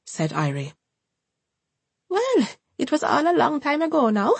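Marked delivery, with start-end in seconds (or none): 2.1-4.3 high, brittle cheerful voice; forced joviality. A narrator's reading. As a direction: cover it with a fake bright tone. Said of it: "with forced joviality".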